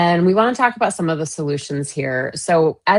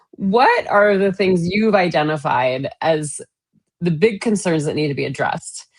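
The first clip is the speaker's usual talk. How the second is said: usual speaking voice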